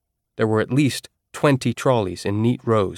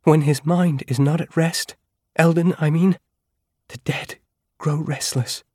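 Eris, voiced lightly higher; 6 semitones higher than usual. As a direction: voice Eris as a nervous girl trying to reassure herself. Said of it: "Eris said".

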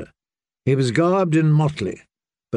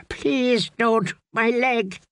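high, pleading please with a quaver; whimpering plea; 9 semitones higher, slower than usual. A narrator's reading. Wounded Dick begging the jester not to strike. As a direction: beg for mercy in pain. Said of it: "Dick whimpered".

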